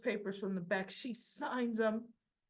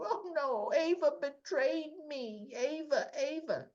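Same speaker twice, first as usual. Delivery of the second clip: mock whining Nina; 6 semitones higher, slower than usual.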